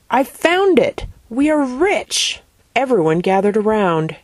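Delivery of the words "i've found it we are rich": high, triumphant call, slowing on the last words; excited yell. A narrator's reading. Father announces his find to the family, yelling excitedly. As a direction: cry out a find in a raised pitch. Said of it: "her father yelled".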